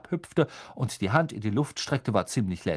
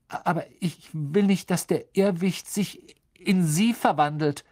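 nervous; 7 semitones higher, 4 dB louder than usual. A narrator's reading. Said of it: "sagte Neville nervös".

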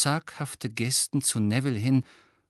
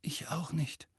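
soft, voiceless hoarse murmur; rather hoarse; 6 semitones higher, 9 dB quieter than usual. A narrator's reading. Ron admitting he didn't know either, quietly hoarse. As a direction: let the voice fail into hoarseness. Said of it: "sagte Ron ziemlich heiser".

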